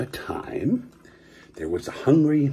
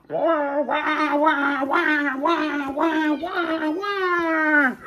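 very high wah wah wailing; 12 semitones higher, much slower than usual. Acted. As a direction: wail in a tiny high voice.

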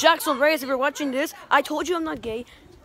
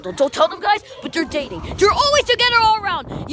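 ordinary talk

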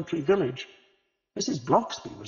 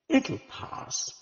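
consoling; 13 semitones higher than usual. A narrator's reading. Comforting grieving Agatha.

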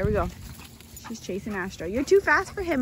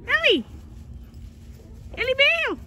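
high ringing call, much louder; imitating her shouting; calling the dog; 3 dB louder, faster than usual. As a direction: call the dog across the yard. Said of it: "She goes".

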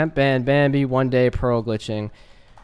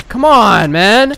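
exasperated protest; 5 semitones higher, 6 dB louder, much slower than usual. Real, not acted. Attacked while fighting.

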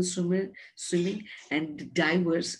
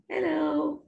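a higher hello, as a toy duck; lightly voiced duck; 8 semitones higher than usual. Duck saying hello to the teacher's friends, lightly voiced creature. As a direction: lightly play a duck greeting children. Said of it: "say hello to my friends".

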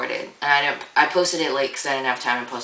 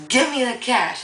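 demanding shout; 9 semitones higher than usual. Retold or imitated. Robber demanding cash in dream.

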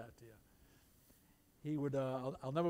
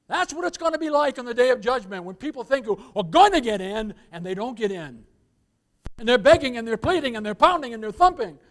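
loud, raised fire-and-brimstone preaching; forceful pulpit delivery; 6 semitones higher, 15 dB louder than usual. Real, not acted. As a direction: drive home the day of judgment.

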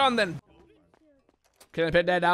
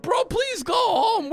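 high, drawn-out plea through laughter; exasperated pleading; 10 semitones higher, much slower than usual. Retold or imitated. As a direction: beg it high, half laughing. Retells pleading with armed neighbor.

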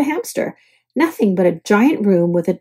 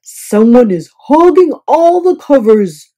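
louder, fed-up complaint; 7 dB louder than usual. A narrator's reading. Guest phoning desk again, fed up. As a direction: bark it down the phone. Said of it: "called the front desk again".

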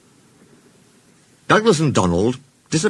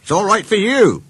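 mildly indignant, higher little-engine voice; fuming; 10 semitones higher, 4 dB louder than usual. Acted. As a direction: complain crossly, pitch raised. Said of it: "fumed Mike".

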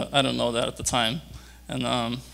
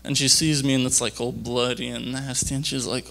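ordinary talk

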